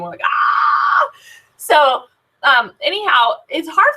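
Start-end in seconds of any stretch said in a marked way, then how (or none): 0.2-1.1 very high, drawn-out mock scream of delight; excited squeal. Showing how sellers greet a new box, excited squeal. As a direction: squeal like a thrilled fan. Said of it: "get excited like we do".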